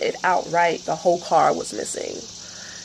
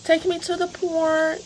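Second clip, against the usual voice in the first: higher pleading pet voice; voicing the dog; 9 semitones higher, 3 dB quieter than usual. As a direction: speak for the dog, begging for the park. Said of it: "He's like".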